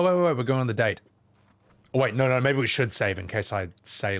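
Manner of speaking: usual speaking voice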